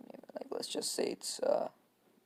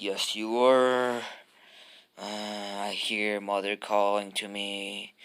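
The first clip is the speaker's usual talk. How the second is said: slow, drawn-out eerie voice; Gaara; 5 dB louder, slower than usual. Acted. Sand-wielding kid accuses Naruto and hears mother, slow and eerie. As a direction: stretch the words out as if possessed. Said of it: "Gaara impression".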